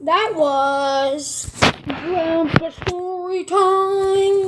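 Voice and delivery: very slow, stretched words; drawn-out closing line. Acted. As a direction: stretch it out like a show outro. Kid closes story segment.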